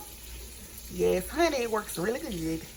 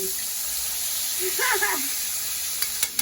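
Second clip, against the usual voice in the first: breathy high laugh; 12 semitones higher than usual. Real, not acted. Laughs at spoiling mama.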